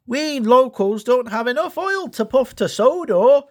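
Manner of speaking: a high fretful little loco; Dash voice. A narrator's reading. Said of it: "he said".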